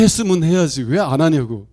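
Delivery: exasperated griping about broken promises; irritated Seoul listener scolding; Seoulite complaint. Retold or imitated. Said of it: "이 서울 분들은".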